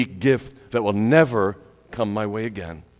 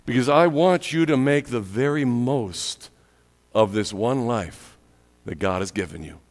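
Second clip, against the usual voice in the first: ordinary delivery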